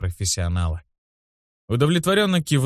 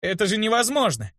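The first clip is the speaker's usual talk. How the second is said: high-pitched protest; indignant boy; 11 semitones higher than usual. A narrator's reading. Boy protests he cannot control sleep, indignant. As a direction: insist on it in a high voice. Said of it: "возмутился я".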